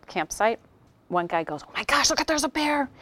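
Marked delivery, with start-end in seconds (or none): 1.6-2.9 high, startled gasp of a line; mild mock alarm at a bear. Storyteller voicing a hiker seeing a bear, startled.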